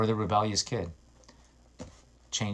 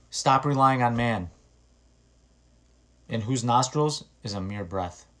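scripture reading; 2 semitones higher, 5 dB louder than usual. Acted. Reads scripture aloud.

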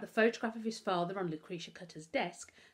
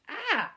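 sudden high loud yelp of pain; sharp pained cry; 13 semitones higher, 7 dB louder than usual. A narrator's reading. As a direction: yelp as the splinter is pulled. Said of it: "he cried out".

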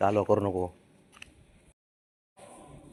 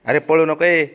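loud, higher shout to stop; 5 semitones higher, 8 dB louder than usual. Real, not acted. Owner shouts at the running dog to stop. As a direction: yell at him not to run off.

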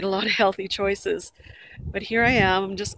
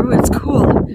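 impressed ooh; 4 semitones higher, 9 dB louder, slower than usual. Real, not acted. Admiring the river view.

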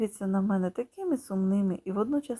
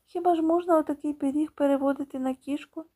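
mother's gentle reproach, higher on the question; reproachful; 6 semitones higher than usual. Acted. Mother gently reproaching her son. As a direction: chide him, half amused. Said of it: "каже вона докірливо".

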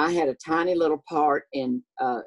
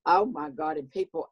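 ordinary talk, nothing marked

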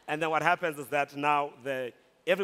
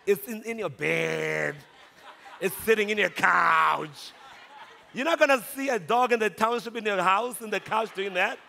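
comic outrage; 5 semitones higher, 5 dB louder than usual. Retold or imitated. Storyteller mocking pampered house dogs to colleagues, comic outrage. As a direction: play it scandalised, stretching the key words.

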